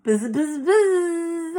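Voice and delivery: high, playful buzzing like a bee. Pretend. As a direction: buzz it out like a busy bee. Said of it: "I'm gonna keep pretending like I'm a bee".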